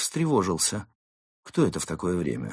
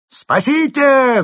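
very high, very loud, drawn-out holler; gleeful top-of-the-voice scream; full-throated yell; 12 dB louder, much slower than usual. A narrator's reading. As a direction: bellow it out with gusto. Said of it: "заорал разбойник".

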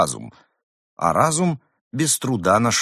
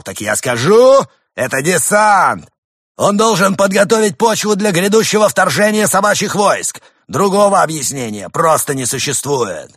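proclaiming loudly; 10 semitones higher, 8 dB louder than usual. Acted. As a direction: announce the invasion like a demagogue. Leader declares invasion.

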